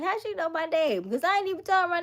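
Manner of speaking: high, fast, incredulous; shocked. Retold or imitated. Reacting to the lady knowing her name, shocked. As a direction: rush it out in shock.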